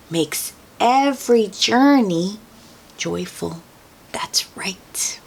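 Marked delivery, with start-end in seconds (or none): 4.1-5.2 soft closing whisper. Real, not acted. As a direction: whisper it gently to finish. Hushed closing affirmation.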